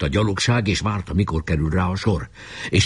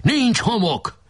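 high call from above; shouting down; 14 semitones higher, slower than usual. A narrator's reading. Boy calls down from the fort, high shout. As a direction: report it in a high cry. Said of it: "lekiáltott az erődről".

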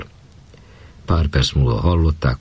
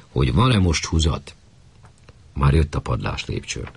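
ordinary delivery, nothing marked

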